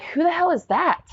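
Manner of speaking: baffled. Retold or imitated. Startled by unknown nurse counting them.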